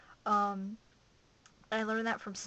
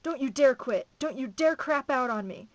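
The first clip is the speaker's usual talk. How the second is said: loud, higher, hard-driving command; raw angry order; 4 semitones higher, 11 dB louder than usual. A narrator's reading. Metz goading the exhausted narrator on, loud and raw. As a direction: berate someone to keep them going. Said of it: "She was getting pretty raw by then".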